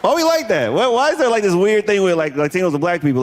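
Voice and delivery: ordinary talk, nothing marked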